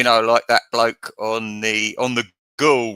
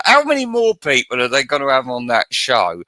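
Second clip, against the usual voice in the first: ordinary talk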